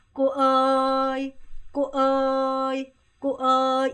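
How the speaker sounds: 꼬어이 stretched slowly, level and loud; slow level call. Shown as a demonstration. Teacher models calling her, slow level call. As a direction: call 꼬어이 long and flat. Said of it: "둘 다 평서".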